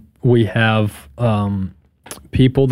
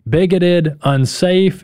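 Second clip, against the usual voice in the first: normal talking voice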